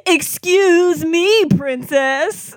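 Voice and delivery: high, slow, smarmy Link voice; hammed-up cartoon Link, high and slow; imitating Link. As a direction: drag out a cheesy cartoon hero line. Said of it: "tagline that he says".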